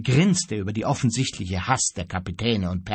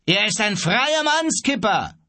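high, raised voice butting in; calling out; 10 semitones higher, 3 dB louder than usual. A narrator's reading. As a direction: call your objection across the beach. Onlooker calls into the shouted quarrel. (laut dazwischenrufen)

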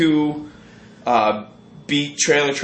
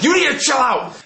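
angry shout; 12 semitones higher than usual. Retold or imitated. To the girl he pinned against a truck, angry shout. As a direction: bark it at her as you shove.

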